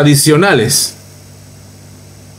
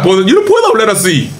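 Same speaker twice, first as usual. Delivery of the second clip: mock newscaster voice; 6 semitones higher than usual. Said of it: "con ese estilo".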